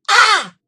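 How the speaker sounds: sudden loud high shout ah; jump-scare the kids with a yell. Retold or imitated. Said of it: "I'd scream".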